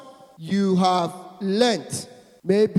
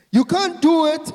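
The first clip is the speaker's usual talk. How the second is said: emphatic preaching; 7 semitones higher, 8 dB louder than usual. Real, not acted. Preacher rising to emphatic peak.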